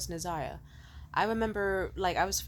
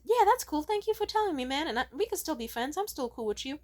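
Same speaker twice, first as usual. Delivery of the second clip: forced cheerfulness; 8 semitones higher than usual. Retold or imitated. Forced cheerful reply to rejection.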